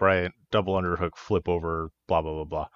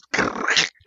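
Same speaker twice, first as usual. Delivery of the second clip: harsh crunching noise made by mouth; imitated dislocation crunch. Wrestler imitating opponent's arm dislocating, crunchy noise. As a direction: mimic an arm popping out. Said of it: "and it goes".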